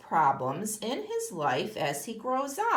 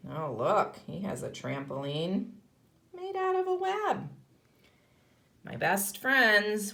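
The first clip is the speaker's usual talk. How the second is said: storybook reading to a class; reading a picture book; 3 semitones lower than usual. Acted. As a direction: read it like a picture book for kids. Teacher reads picture book aloud.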